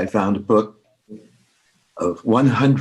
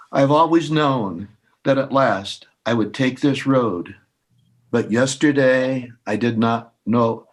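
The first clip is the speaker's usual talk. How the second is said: measured, unhurried recitation with pauses between poems; poem reading. Acted. Reading Japanese poems aloud to listeners, in a calm recital voice. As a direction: let each short poem settle before the next.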